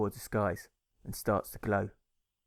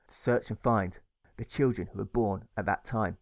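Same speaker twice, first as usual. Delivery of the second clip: plain reading voice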